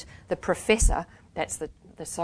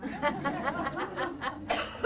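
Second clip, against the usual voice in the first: soft laugh; 8 semitones higher, 8 dB quieter than usual. A narrator's reading. After mouthing.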